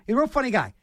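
ordinary delivery

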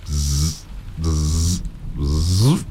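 buzzing bee imitation. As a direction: buzz like a bee at the window. Imitation again.

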